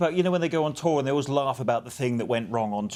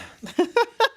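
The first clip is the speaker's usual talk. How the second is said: quick laugh; 4 dB louder than usual. Real, not acted. Joke about sixty percent.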